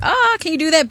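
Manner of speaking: high-pitched mock amazement; amazed reaction. Acting own amazed reaction.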